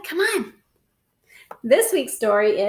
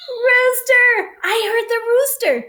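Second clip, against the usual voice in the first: high, loud call of the animal name; bright excited shout of the name; excited announcement; 8 semitones higher, 3 dB louder than usual. Acted. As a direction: call it out for the kids. Announcing the sound button.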